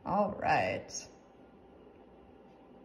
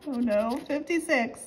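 usual speaking voice

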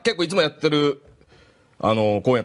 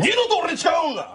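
imitated angry shout. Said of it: "その声でびっくりして".

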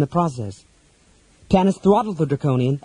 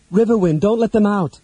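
raised, higher urgent call; crying out; 7 semitones higher, 3 dB louder than usual. A narrator's reading. Tanis to Riverwind as hobgoblins make for the door, crying out. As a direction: call it out to a companion across the fight. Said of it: "Tanis cried".

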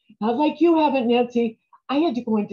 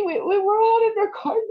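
crying; 9 semitones higher, faster than usual. Acted. Mother recalls her dead mother.

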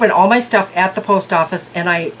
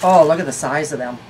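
ordinary talk, nothing marked

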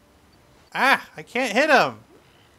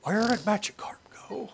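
high whispered question; 6 semitones higher than usual. Pretend. Player looks for missing Magikarp, playful whisper.

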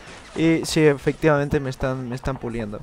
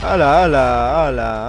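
drawn-out, slow, loud "hala"; loud amazed exclamation; 8 dB louder, slower than usual. Real, not acted. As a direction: stretch it out in shocked awe. Reacting to chaos on screen. (exclamación asombrada y lenta)

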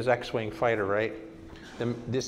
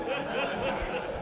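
breathy unvoiced chuckle; airy laugh; 12 semitones higher, 6 dB quieter than usual. Real, not acted. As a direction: laugh at your own joke. Joke about the name gras.

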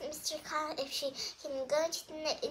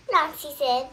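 ordinary delivery, nothing marked

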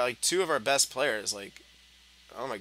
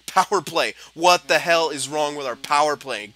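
exasperated; 4 semitones higher, 9 dB louder than usual. Real, not acted. Angry at failed power play.

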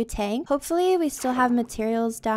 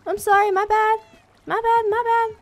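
panicked apology; 11 semitones higher, 9 dB louder than usual. Real, not acted. Accidentally hurts the cats in-game.

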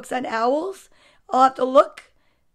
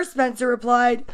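ordinary delivery, nothing marked

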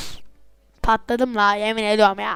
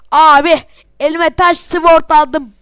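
ordinary delivery

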